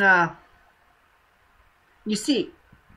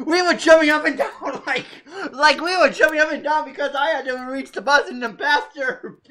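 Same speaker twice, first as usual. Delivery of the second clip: higher and louder, laughing through the words; laughing with glee; 5 semitones higher, 4 dB louder than usual. Real, not acted. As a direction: bubble over with giddy laughter. Retelling jumping for joy.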